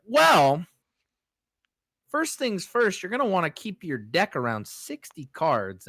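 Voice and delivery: high, loud patronizing explanation; condescending old lady. Retold or imitated. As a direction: talk down to a newbie. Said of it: "So then she's like".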